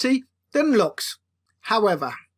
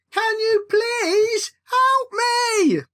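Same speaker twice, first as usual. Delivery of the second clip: desperate plea; 11 semitones higher, much slower than usual. Acted. Jacob blown about, begging brother.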